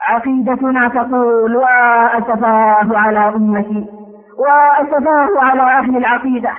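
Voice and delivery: ordinary talk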